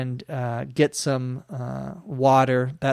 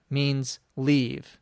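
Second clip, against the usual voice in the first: ordinary talk, nothing marked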